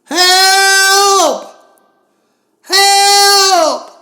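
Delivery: very high, very loud cries for help; desperate screaming. Acted. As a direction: scream for help with everything you have. Alone, wakes, screams again.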